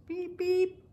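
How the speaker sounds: high, tooting car horn voice; mimicking a Jeep horn; honking beep beep. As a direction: toot it like a horn.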